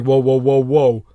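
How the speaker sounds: loud mock protest, whoa repeated; a hammed-up girlfriend yelling whoa; mock alarmed shout. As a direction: shout it like a manipulator caught out. Mocking manipulative girlfriend, acted.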